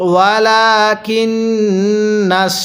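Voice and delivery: slow chanted ওয়ালাকিন্নাশ with a long humming "nn"; ghunnah recitation. Shown as a demonstration. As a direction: hold the nun in the nose, level and slow. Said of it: "এই নুনের মধ্যে আমরা গুনাহ করব". (নুনে গুন্নাহ করে ধীরে তিলাওয়াত)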